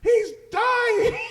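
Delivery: high, very loud imitation yell; panicked onlooker's shout. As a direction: mimic a frantic parent yelling from the crowd. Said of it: "the father or, uh, or whoever".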